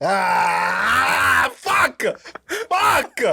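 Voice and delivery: very high yell of real frustration; exasperated shout. Real, not acted. Guest realises he missed the phrase, high exasperated shout. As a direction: cry out high as if you just missed it.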